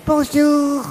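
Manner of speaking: squeaky high-pitched, loud put-on hello; silly greeting voice. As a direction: chirp it like a cartoon character. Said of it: "puis il fait".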